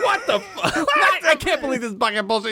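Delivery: high-pitched, hammed-up outburst; comic outraged squawk; mock fed-up yell. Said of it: "just yells some shit in Korean".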